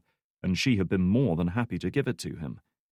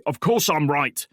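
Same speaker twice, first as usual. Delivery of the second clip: slow, louder, higher rage; raging; 6 semitones higher, 6 dB louder than usual. Acted. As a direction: roar it, drawn out. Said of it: "he raged".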